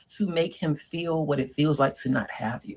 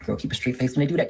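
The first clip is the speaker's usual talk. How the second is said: ordinary talk, nothing marked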